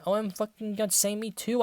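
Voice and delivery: ordinary talk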